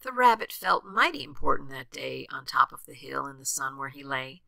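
ordinary delivery, nothing marked

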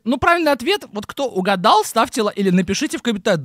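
high, sing-song parody of a presenter; mocking the host. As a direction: mimic her chirpy outro. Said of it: "она говорит".

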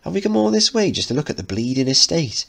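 put-on high, cheeky teenage girl. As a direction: play the bored Scouse teen. Teen Linda teasing Mickey.

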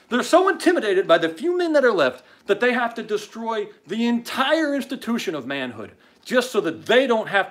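high, loud, impassioned tirade; outraged rant. Real, not acted. Host rails about what was done to America, outraged rant. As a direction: rail in disgust, punching the insults.

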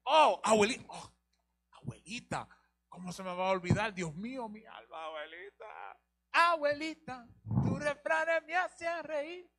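llanto fingido por la abuela, agudo y lento; lamento burlón. Acted. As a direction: exagera el duelo de los parientes. Mocks relatives' graveside lament. (lamento exagerado y burlón por la abuelita)

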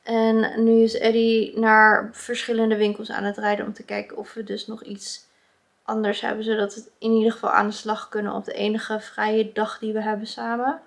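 ordinary delivery

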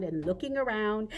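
usual speaking voice

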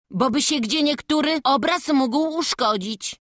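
put-on lively, boastful boy reassuring elders; confident boy. Boy tells elders not to fret over his climb, confident. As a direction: brush off their worries like a cocky lad.

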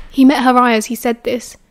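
ordinary delivery, nothing marked

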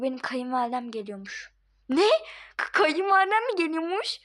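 normal talking voice

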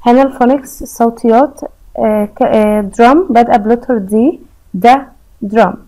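usual speaking voice

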